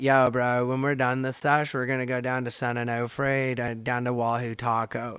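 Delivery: imitating surfers in Laguna Beach, laid-back surfer dude accent. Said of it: "they would say things like".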